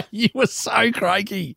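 laughing through the words, slow; can't stop laughing while teasing. Real, not acted. Laughing at her rejected friend, teasing through laughter.

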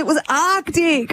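slow, drawn-out ARCTIC; stress on Arctic. Real, not acted. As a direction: lean on "Arctic" slowly. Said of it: "it's called Arctic Park for a reason".

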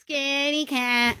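slow high mocking taunt; drawn-out cheeky child tease; high kid sneer. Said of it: "he's going".

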